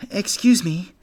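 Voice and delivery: put-on high, loud little boy's call; forceful. A narrator's reading. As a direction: push it out as firmly as you can. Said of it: "as forcefully as a young bunny can".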